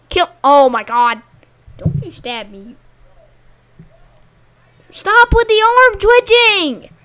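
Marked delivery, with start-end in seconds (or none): 4.9-6.9 exasperated yell. Real, not acted. Annoyed at players.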